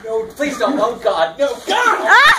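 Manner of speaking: yelping at icy water; shocked shout. Real, not acted. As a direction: cry out as freezing water hits. Ice water poured on him.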